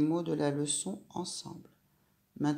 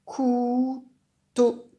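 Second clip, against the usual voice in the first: "Couteau" revealed loud and much higher; word revealed; 8 semitones higher, 8 dB louder than usual. Shown as a demonstration. Teacher triumphantly revealing "Couteau" to the child, loud. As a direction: cheer out "Couteau" as the answer. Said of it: "Tu as trouvé le mot?".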